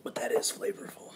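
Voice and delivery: whispered appreciation of the drink; a hushed aside about the flavour. Real, not acted. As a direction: breathe it out like a secret. Tasting the scotch.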